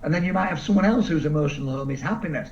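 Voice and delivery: ordinary delivery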